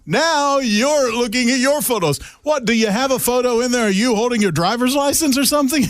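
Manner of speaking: high, mock-accusing teasing. Real, not acted. Host teasing co-host for checking phone photos, high mock accusation. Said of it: "teasing co-host about checking photos".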